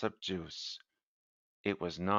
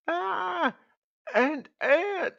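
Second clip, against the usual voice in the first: high startled cry of alarm; startled shriek; much slower than usual. Acted. Sick man finds an ant, high startled shriek. Discovers ant under blanket.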